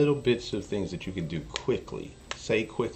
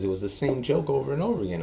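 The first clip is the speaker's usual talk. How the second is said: normal talking voice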